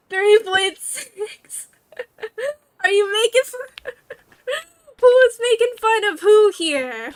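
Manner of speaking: high, loud words through laughter; real laughter. Real, not acted. Streamer asking chat who's making fun, laughing hard. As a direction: talk while cracking up.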